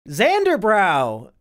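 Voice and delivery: 「Xanderbrow!」をゆっくり引き伸ばして大声で呼ぶ; 遠くの人を呼ぶように名前を長く伸ばす; calling out. Real, not acted. Calls out to a chatter.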